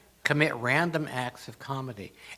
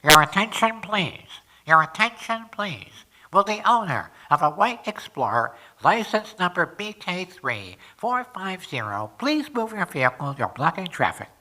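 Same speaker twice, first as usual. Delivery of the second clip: loud, higher, over-the-speaker announcer call; PA announcement; 6 semitones higher, 8 dB louder than usual. Retold or imitated. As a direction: boom it out like the public address system. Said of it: "from the P A, I'm interrupted. We hear".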